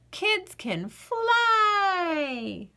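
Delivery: slow, stretched-out wonder; dreamy drawn-out kid. Acted. Girl telling the potato kids can fly, slow and dreamy. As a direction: let the word float like flying.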